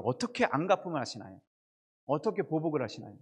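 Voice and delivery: ordinary talk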